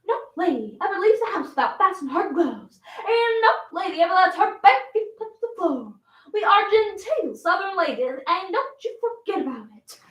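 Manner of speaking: high-pitched lecture on manners; prim, insistent maternal scolding; scolding Southern mama. Acted. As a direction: lay down the rules like a genteel mother. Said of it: "Yes, Mama".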